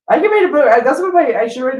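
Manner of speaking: bursting out loud and high; sudden excited exclamation. Real, not acted. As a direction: blurt it out, thrilled.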